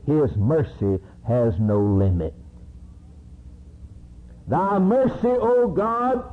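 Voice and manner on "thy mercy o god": high, lifted scripture recitation; raised voice of praise; psalm recited. Acted. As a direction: intone it like a psalmist. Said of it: "David said".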